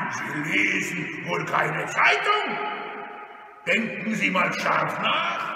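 loud, mocking robber voice; blustering villain's taunt. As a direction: jeer at the victim, loudly.

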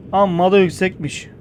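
normal talking voice

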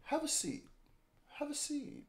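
Chris Hansen. Retold or imitated. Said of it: "make him sit Chris Hansen".

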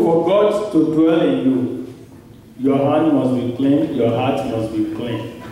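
ordinary talk, nothing marked

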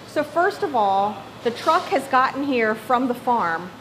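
ordinary talk, nothing marked

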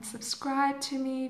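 normal talking voice

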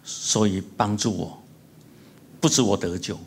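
earnest, measured prayer voice; closing prayer. Acted. Pastor leading the church in prayer, earnest and measured.

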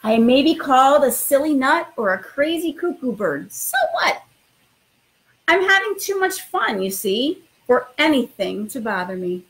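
expressive storytime read-aloud; reading to children. Acted. Principal reading a picture book to new students, lively storytime voice. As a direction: read it like bedtime story time for little kids.

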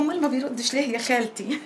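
teasing imitation with a giggle; mocking echo through laughter. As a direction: parrot her worried question back, laughing. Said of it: "والله وانكشفتي وانفضحتي يا سماح". (تقليد ساخر وهي بتضحك)

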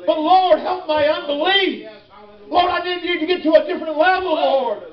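high, fervent preacher's cry; full-force preaching. Acted. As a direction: cry out to God from the pulpit.

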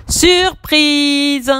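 high, drawn-out cheer; spring it on papa; gleeful surprise. Acted. The children surprising papa, high and gleeful. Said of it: "s'exclament en chœur Samy et Julie".

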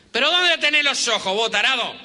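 high, loud street yell at a careless driver; angry taxi driver. Retold or imitated. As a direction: bellow at the bus driver like a furious cabbie. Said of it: "baja el taxista con otro acento y dice".

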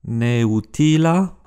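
"neutila" very slowly as ne-u-ti-la; "neutila" drawn out syllable by syllable; four syllables. Shown as a demonstration. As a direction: split the e and u in "neutila". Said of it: "quatre syllabes".

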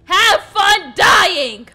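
loud, slow, stretched-out threat; drawn-out vengeful send-off; vengeful yell. Acted. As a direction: shout each word as you strike. Acted revenge attack.

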